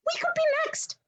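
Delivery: high frightened wail; fearful squeak; panicked. Acted. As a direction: voice jumps up in fear. Mike panicking.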